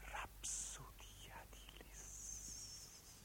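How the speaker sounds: very slow, drawn-out whisper; fading whisper. Acted. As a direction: dissolve the words into breath. Recitation fades to its end. (sussurro lentissimo che svanisce)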